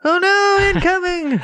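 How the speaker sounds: squeaky high cartoon panic; hammed-up falsetto alarm; mock alarm. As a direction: shriek it like a cartoon air-raid warning. Mock alarm at a passing airplane.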